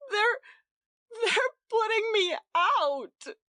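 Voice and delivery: high, loud, slow wail; a wife wailing to her husband that she is being thrown out. A narrator's reading. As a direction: wail it out in distress. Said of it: "she wailed".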